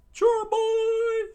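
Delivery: very high, loud squeaky shout; Sanji screaming. Acted. Sanji yelling at Luffy, high loud squeal. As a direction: shriek it at Luffy. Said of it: "Sanji screamed out".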